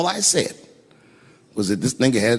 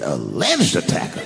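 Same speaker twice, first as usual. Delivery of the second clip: slow, lower, questioning repeat; drawn-out disbelieving question; incredulous echo; 5 semitones lower, slower than usual. Retold or imitated. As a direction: query the phrase in mock disbelief.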